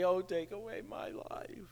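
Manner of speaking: mocking a self-pitying sulk, dropped soft; pouting whine. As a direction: whine it like a pouty child. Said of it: "He's just pouting".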